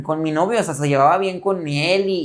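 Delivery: ordinary delivery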